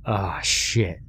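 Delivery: ordinary delivery, nothing marked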